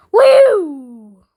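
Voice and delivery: loud high whoop; loud excited cowboy whoop. Pretend. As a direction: whoop like a rodeo rider. Cowboy whoop in Wild West game.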